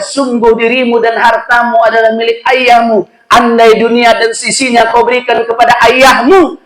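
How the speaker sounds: put-on the Prophet sternly declaring to the young man; solemn proclamation. The Prophet rebukes the son.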